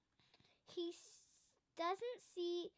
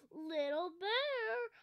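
louder, slow, stretched "Little Bear" in a mock-scary daddy call; a hammed-up monster roar for a child, louder; playful roar; 3 semitones higher, 6 dB louder, slower than usual. A narrator's reading. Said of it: "he roars".

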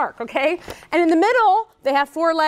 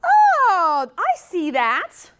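put-on kid's dawning realization; 3 semitones higher, much slower than usual. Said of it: "and they're going".

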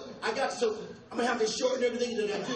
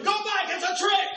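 warning shout; 8 semitones higher, 4 dB louder than usual. Retold or imitated.

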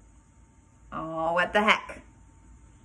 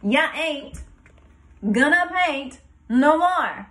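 slow, louder mock holler from mama; a parent's scolding yell, slowed and raised; mama's holler; 6 semitones higher, 5 dB louder than usual. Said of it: "I heard my mama holler like I never did before".

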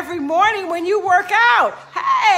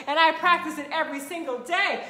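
ordinary talk

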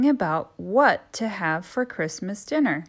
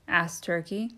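usual speaking voice

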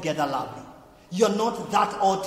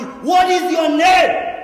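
high, slow, shouted demand; 10 semitones higher, 12 dB louder, much slower than usual. Retold or imitated. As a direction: shout the question slowly as the crowd would. People demanding God's name.

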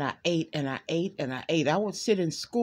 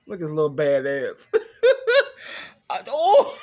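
laughing at the cat; 4 semitones lower, 5 dB louder than usual. Real, not acted.

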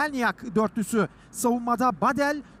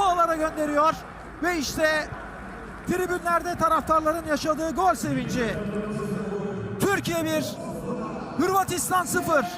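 excited commentator; 6 semitones higher than usual. Real, not acted. Goal celebration commentary.

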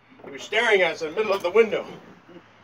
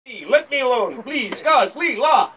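higher-pitched begging cry; mock pleading; 6 semitones higher, 5 dB louder than usual. Comedian begging God for mercy, mock-desperate. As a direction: plead dramatically to be left alone. Comic pleading to be left alone.

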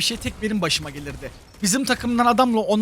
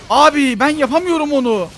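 loud, high-pitched complaint; exasperated protest; 6 semitones higher, 7 dB louder than usual. Real, not acted. Gamer admitting failure mid-fight, exasperated protest. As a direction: protest loudly that it can't be done.

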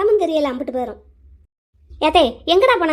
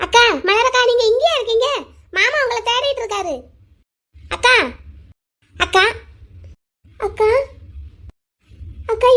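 calling out; 3 semitones higher than usual. Acted. Younger girl looking for her missing sister, calling out. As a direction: call out for an elder sister.

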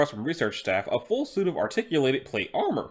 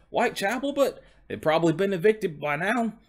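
higher, put-on Cockney horse; Cockney coach horse; 6 semitones higher than usual. Horse guessing where his family went, Cockney accent. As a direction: speak pitched up in a Cockney accent. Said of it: "he's speaking in a Cockney accent".

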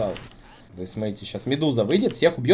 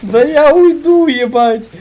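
much higher, louder, slow squeaky mimicry; mocking teammate; 13 dB louder, much slower than usual. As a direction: parrot his line in a silly squeal.

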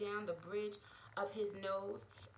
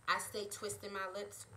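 ordinary delivery

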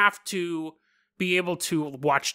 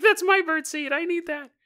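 much higher, mock whining; whiny daughter; 11 semitones higher than usual. The daughter complaining to her mom about the birdseed, mock whine. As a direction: whine like a child whose food was taken. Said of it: "The daughter's crying".

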